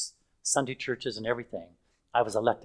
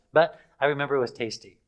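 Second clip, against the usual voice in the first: usual speaking voice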